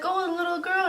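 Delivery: put-on high, light toddler-like reply; little child's answer. Retold answer of small child.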